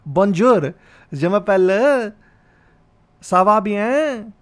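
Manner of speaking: loud French with a heavy Indian accent. Shown as a demonstration. Comic showing how he learned French, thick Indian accent. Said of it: "I say it with an Indian accent".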